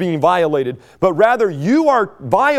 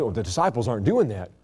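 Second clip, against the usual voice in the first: mock Pharisee gossip; 9 semitones lower, 7 dB quieter, faster than usual. Voicing the Pharisees' accusation.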